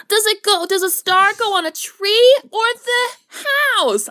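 put-on high, sweet little-girl voice; sing-song cutesy voice. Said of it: "a cutesy voice".

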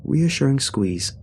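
ordinary delivery, nothing marked

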